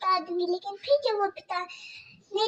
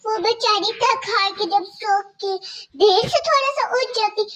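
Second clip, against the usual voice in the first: ordinary delivery, nothing marked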